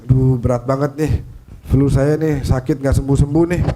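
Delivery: ordinary talk, nothing marked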